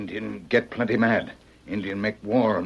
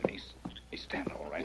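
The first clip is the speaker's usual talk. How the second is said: whispering; 6 semitones lower, 13 dB quieter than usual. Acted. Wounded man getting up.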